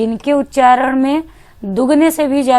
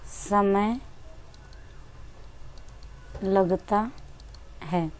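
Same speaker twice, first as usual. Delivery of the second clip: softer, word by word, long gaps between words; slow dictation; 3 semitones lower, 13 dB quieter, slower than usual. Shown as a demonstration. Teacher writing the definition, dictating softly to the class. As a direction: dictate slowly while writing on the board. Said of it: "मैं फिर से इसको right कर दे रही हूँ".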